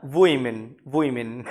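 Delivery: वुमेन as WUI-min; slow wui diphthong opening वुमेन; wrong pronunciation. Shown as a demonstration. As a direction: glide from "woo" to "ee" in वुमेन. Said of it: "completely wrong".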